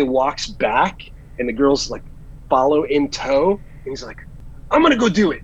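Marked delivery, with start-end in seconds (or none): 4.7-5.4 higher, louder burst of determination; eager resolve. Retold or imitated. Buddy announcing he'll chase the autograph, eager. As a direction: blurt it out like an excited buddy.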